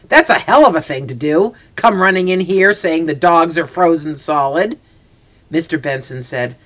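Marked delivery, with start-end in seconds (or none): none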